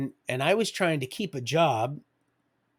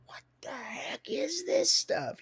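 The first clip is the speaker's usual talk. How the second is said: high, slow, breathless whisper; hushed high amazement, drawn out slowly; awed whisper; slower than usual. Retold or imitated. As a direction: whisper it in stunned disbelief. Stunned by the potent weed.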